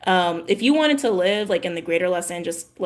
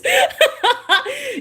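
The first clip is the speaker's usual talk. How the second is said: loud high-pitched burst of laughter; big high laugh; 4 dB louder than usual. Real, not acted. Vlogger cracking up at a viewer's joke. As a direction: cackle at the joke.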